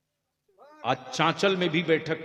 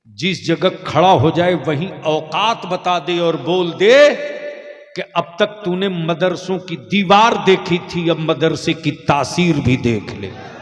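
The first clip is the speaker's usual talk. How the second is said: full-force preaching shout; 3 semitones higher, 14 dB louder than usual. Acted. Preacher roaring a challenge about madrasas to the gathering. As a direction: thunder the challenge at the crowd. Preacher's rousing climax to crowd.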